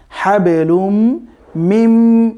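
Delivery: হাবেলুম মিম run together with a long nasal m; joined mim. Shown as a demonstration. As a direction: hum through the join in হাবেলুম মিম, slowly. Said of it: "এই মিমের সাথে connected হবে".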